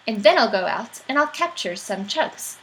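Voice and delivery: plain reading voice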